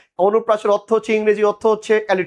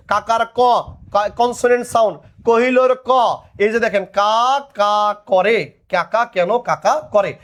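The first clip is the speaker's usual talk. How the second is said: normal talking voice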